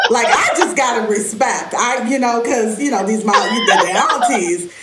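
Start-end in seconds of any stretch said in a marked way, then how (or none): none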